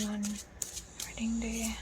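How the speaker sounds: breathy hush on the question; whisper. Acted. Tarot reader whispering to viewers about the wedding day. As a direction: whisper it like a secret reveal.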